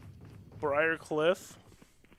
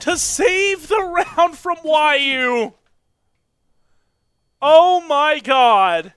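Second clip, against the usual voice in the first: excited shout; 12 semitones higher, 17 dB louder, much slower than usual. Real, not acted. Caster hypes the clutch.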